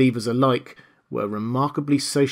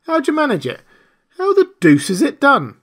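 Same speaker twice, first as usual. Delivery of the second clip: very high, louder, astonished; amazed squeal; 7 dB louder than usual. A narrator's reading. Marvel begs the invisible man to explain, astonished. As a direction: shriek your wonder.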